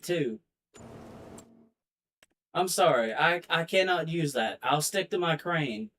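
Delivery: usual speaking voice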